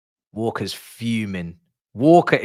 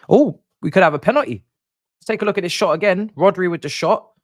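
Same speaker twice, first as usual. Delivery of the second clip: higher, louder and quick, sudden excitement; excited penalty shout; 9 semitones higher, 7 dB louder than usual. Real, not acted. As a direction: jump on the chance of a penalty. Watching a possible penalty live.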